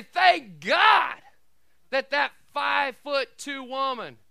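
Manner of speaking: loud, high preacher proclamation; full-force preaching. Real, not acted. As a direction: punch the key words at full preaching volume.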